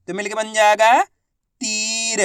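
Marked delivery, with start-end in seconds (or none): 1.6-2.2 "तीर" with the ई held long; long drawn-out ī. Shown as a demonstration. Teacher blending the letters into a word, "तीर" drawn out. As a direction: stretch the middle of "तीर". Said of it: "बड़ी ई की मात्रा को खींच के पढ़ा जाता है".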